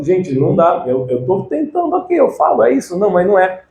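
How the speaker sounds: high-pitched protest of a struggling learner; frustrated student. Acted. Student telling the class about failed attempts, frustrated. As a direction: act out a student pleading that it won't work.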